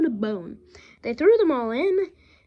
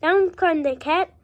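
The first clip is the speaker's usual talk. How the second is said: plain reading voice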